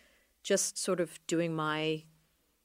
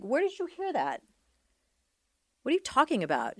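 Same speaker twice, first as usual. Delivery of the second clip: retold disbelief; 8 semitones higher than usual. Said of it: "I'm like".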